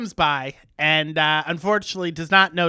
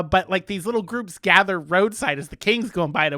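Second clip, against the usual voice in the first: ordinary delivery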